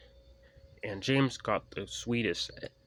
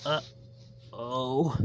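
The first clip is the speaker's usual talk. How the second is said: shaking; 4 semitones higher than usual. A narrator's reading.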